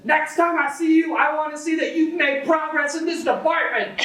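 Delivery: very high, squeaky stranger yelling; shrill mock yell. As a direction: screech it like an unhinged street guy. Stranger's parting warning.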